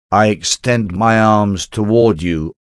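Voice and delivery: usual speaking voice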